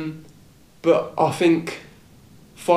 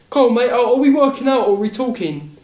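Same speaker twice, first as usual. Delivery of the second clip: higher and louder, goading a stranger with a jeer; mocking taunt; 8 semitones higher, 4 dB louder than usual. Lad with a knife mocking the guy at the pull-up bar, taunting jeer. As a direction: sneer it out like you're picking a fight.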